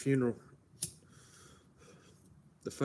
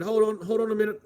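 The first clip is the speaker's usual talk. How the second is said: raised and higher, halting someone; a touch of an urgent cop; cop ordering a pause; 8 semitones higher, 5 dB louder than usual. Retold or imitated. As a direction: play the cop calling a stop. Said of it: "the cop said".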